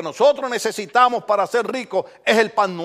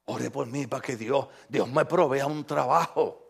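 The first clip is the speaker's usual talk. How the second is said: softer, humble plea; soft pleading request; 7 semitones lower, 7 dB quieter than usual. Retold or imitated. Church member begging the pastor for prayer, soft plea. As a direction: ask gently like a needy church member. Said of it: "hermanos que han venido".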